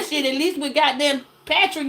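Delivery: normal talking voice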